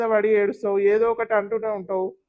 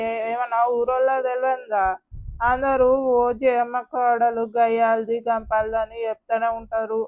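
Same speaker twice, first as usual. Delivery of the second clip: gossiping villager. Acted. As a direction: needle Jayamma about her daughter-in-law.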